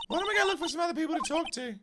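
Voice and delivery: put-on high, quick girlish suggestion; girl sidekick. Girl urges lawyer to move on, high and quick. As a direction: suggest it fast in a high girl's voice. Voices girl in game dialogue.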